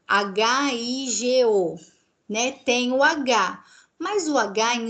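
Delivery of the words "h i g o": "H I G O" slow, letter by letter; spelled out. Shown as a demonstration. Teacher spelling Spanish word for class, slow. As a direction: spell it out slowly. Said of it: "que é figo".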